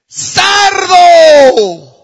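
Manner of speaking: sudden high shout across the road; shouted insult. Acted. Woman yelling at the driver as the cars pass, shouting. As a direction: yell it at the passing driver. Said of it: "la mujer le grita al hombre".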